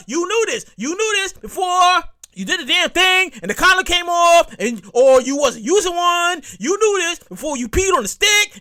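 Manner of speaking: loud, high rant; shouting rant. Real, not acted. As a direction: yell it at the friend.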